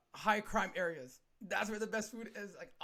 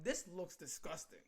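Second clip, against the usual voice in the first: muttered aside; 9 dB quieter, slower than usual. Retold or imitated. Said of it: "I'm like".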